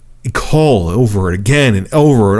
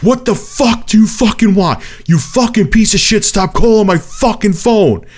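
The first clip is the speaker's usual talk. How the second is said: high, furious re-enacted rant; losing his temper; 9 semitones higher than usual. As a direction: blow up at a relentless caller, pitch raised. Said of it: "I lost my shit".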